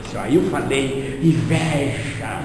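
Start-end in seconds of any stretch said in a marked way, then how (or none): 1.2-2.4 "Inveja" drawn out slow and weighty; with vehemence. Shown as a demonstration. Medium stresses "Inveja" to the lady with slow vehemence. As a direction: lean hard on "Inveja", slowly. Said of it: "com veemência".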